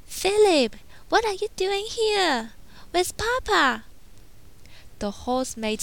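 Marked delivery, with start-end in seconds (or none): 0.1-3.8 alarmed heroine. A narrator's reading. Belle finding her father's horse alone, high worried call.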